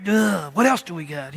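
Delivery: mock groan, then grumbled question; exasperated groan. Herod thwarted without his lions, exasperated. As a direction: sigh it out in comic frustration.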